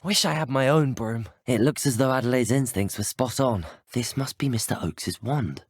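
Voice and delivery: usual speaking voice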